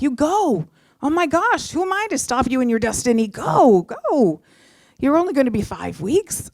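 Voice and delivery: high, emphatic cheering on; urging encouragement. Retold or imitated. Girlfriend urging him to follow his destiny, retold high excited encouragement.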